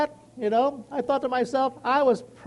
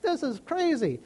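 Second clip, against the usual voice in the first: high, slow mock exasperation; frustrated outburst; 10 semitones higher than usual. Retelling his upset on Monday, frustrated outburst. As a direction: let the frustration spill out, hammed up. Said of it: "I was like".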